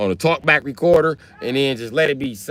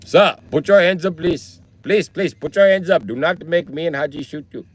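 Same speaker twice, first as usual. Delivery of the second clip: higher, pleading mock Indian accent; 5 semitones higher than usual. Indian robber to his victim, polite mock Indian accent. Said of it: "Can you imagine getting robbed by an Indian guy?".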